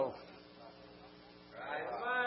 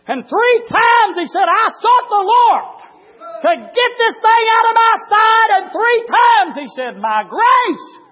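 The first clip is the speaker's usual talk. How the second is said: high, loud, drawn-out pulpit shout; full-force preaching; 14 semitones higher, 11 dB louder, slower than usual. Real, not acted. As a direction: shout the scripture slowly to the congregation.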